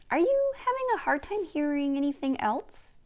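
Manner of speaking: put-on a bright-eyed girl poking fun at her grandma; young Gidgee.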